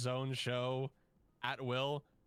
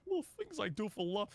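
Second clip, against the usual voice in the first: Courage voice; 9 semitones higher than usual. Shown as a demonstration. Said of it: "Courage the Cowardly Dog voice".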